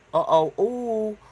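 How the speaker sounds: high, slow uh-oh; uh-oh surprise. Real, not acted. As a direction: realize a mistake aloud. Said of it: "I just fucked up".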